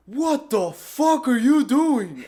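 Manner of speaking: loud, slow, drawn-out cop bark; furious officer shouting at the driver; angry cop yell. Retold or imitated. Cop yells at trespasser.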